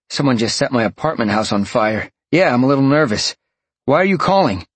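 tense and rushed; shaken, barely holding it; nervous. Acted. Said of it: "You sound worried".

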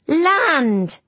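put-on high, drawn-out call of a boy; excited call. A narrator's reading. Boy on deck sights the shore, calling out. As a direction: call out a sighting, pitched well up. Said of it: "he called out".

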